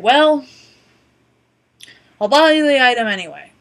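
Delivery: put-on loud, defiant old lady; Martha's retort. Old customer telling the cashier off, loud and defiant. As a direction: announce it to the cashier with spite.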